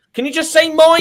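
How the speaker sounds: high, loud pleading imitation; hammed-up whine for attention; mock demanding teammate. Mimicking Liam wanting his score first.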